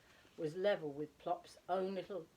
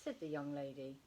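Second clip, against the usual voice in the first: ordinary delivery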